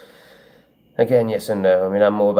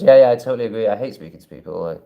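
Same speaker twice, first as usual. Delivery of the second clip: normal talking voice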